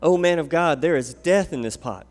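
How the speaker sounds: loud alarmed cry to the prophet. A narrator's reading. Prophets' sons crying out to Elisha over the stew, loud alarm. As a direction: call out in sudden alarm. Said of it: "they cried out".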